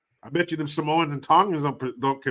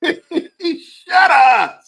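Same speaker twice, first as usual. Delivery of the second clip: laughing disbelief; 10 dB louder than usual. Real, not acted. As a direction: shut up, laughing and much higher and louder. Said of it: "laughing disbelief".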